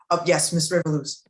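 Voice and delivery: ordinary delivery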